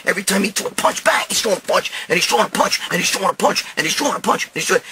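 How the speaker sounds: normal talking voice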